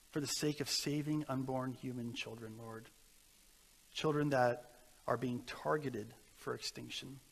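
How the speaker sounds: soft, steady pastoral prayer voice; earnest prayer. Acted. Pastor leads the congregation in a closing prayer. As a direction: lift it up to God humbly.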